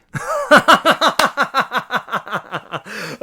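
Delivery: high wheezing laugh; helpless laughter; burst of laughter. Real, not acted. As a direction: crack up at the absurd result. Reacting to friends dying.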